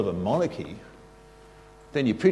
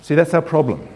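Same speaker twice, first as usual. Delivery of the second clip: ordinary talk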